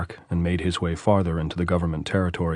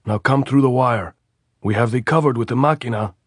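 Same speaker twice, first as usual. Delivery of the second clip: louder order from above; called order; 5 semitones higher, 4 dB louder than usual. A narrator's reading. Sentry calling Andres through the wire, loud. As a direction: call him through the wire. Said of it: "the voice called".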